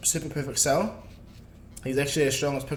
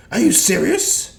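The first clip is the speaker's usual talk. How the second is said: slow, loud, higher cry of disbelief; 4 semitones higher, 9 dB louder, slower than usual. Acted. Goku hears the wish is permanent, loudly appalled. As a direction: drag it out, appalled.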